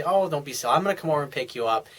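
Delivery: normal talking voice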